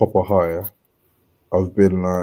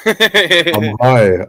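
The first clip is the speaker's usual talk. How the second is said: laughing; 5 semitones higher, 6 dB louder than usual. Real, not acted. Laughs while admitting.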